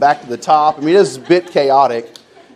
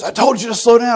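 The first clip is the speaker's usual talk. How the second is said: scolding; 11 semitones higher than usual. Retold or imitated. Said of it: "the guy goes".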